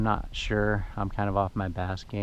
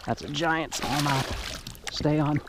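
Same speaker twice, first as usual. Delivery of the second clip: excited pleading; 8 semitones higher, slower than usual. Real, not acted. Fighting a big fish.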